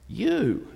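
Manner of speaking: single word "You", pitched high and set apart; stress on you. Shown as a demonstration. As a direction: point straight at the listener. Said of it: "The emphasis falls on the you".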